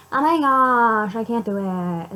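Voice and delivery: mock Debbie Downer. Mocking gloomy people who moan, in a slow whine. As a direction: mimic a mopey complainer, hammed-up. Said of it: "those types of Debbie Downer people that's like".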